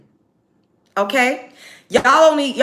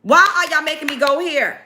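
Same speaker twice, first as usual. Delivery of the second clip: ordinary delivery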